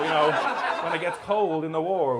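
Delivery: ordinary talk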